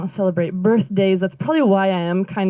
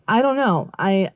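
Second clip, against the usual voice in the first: ordinary talk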